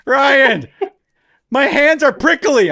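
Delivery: put-on high, loud, plaintive kid's holler; yelling. Stranded kid calling up to babysitter, yelling. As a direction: complain loudly from another floor. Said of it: "he's yelling".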